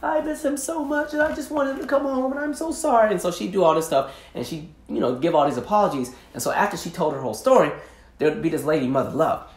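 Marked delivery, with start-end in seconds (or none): 0.0-3.1 pleading guest on a talk show. Retold or imitated. Cheating wife begging on the show, high and pleading. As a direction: beg forgiveness in a high, wounded voice. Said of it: "and she's like".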